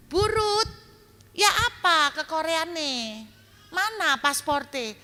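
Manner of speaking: high, lightly voiced friend asking; friend's voice. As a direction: pitch up to play the friend. Friend's WhatsApp voiced in story.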